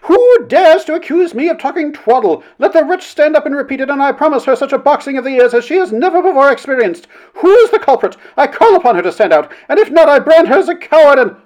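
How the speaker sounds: very high, loud fury; outraged matron at the top of her voice; wrathful outburst. A narrator's reading. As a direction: rage at an unseen heckler. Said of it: "So great was her wrath".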